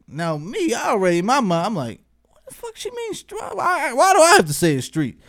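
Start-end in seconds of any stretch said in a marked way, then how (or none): 2.3-5.2 high-pitched baffled protest; mock indignation. Retold inner protest at being singled out.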